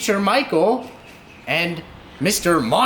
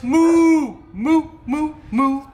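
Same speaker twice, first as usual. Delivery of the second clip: mooing cow; 6 semitones higher, 3 dB louder, slower than usual. Acted. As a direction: moo like a cow. Animal song, mooing.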